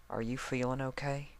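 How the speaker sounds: soft, high coo like a mother hen. Acted. Applejack coos over her trapped friend, soft and tender. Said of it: "Applejack cooed".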